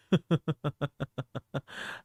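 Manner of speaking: quiet laugh that builds; growing laugh. Acted. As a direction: start subtle then let it grow. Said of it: "start off subtle and then make it grow".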